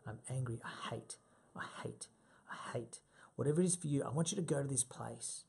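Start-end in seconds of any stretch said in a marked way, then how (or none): 0.0-0.6 softer, letting the anger sink in; quietly feeling it. Shown as a demonstration. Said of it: "close your eyes and say the word". 0.6-3.0 near-whisper, fading; whispered to yourself. Shown as a demonstration. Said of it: "close your eyes and say the word".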